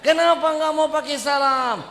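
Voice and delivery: high, loud demanding question; angry demand. Acted. Teacher demanding why, high and loud. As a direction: demand an answer sharply.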